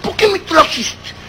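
put-on high, slow lisping kid voice; small child. Child questioning father about the sweet, high baby-talk voice. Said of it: "la clásica pregunta del niño".

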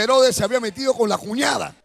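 ordinary delivery

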